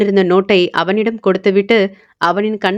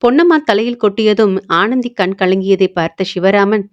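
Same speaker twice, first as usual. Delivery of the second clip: ordinary delivery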